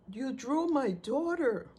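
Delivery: a mother's stunned cry; voicing the mother's high, loud shock; shocked outburst. Grieving mother's outburst retold.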